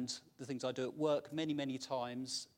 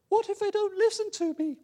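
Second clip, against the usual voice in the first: much higher, louder anxious voice; whiny Moses; 12 dB louder than usual. Acted. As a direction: fret as a nervous Moses. Acting Moses in dialogue.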